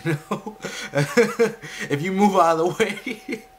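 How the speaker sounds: laughing through the words; giggling while talking. Real, not acted. Laughing at anime logic.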